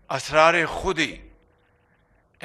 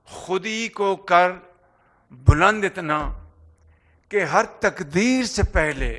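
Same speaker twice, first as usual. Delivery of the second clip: declaimed Urdu couplet, phrase by phrase; stately, emphatic Urdu verse recital; poetry recitation; much faster than usual. Acted. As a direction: recite the verse with weighty pauses between phrases.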